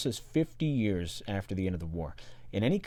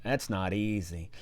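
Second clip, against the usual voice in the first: ordinary talk, nothing marked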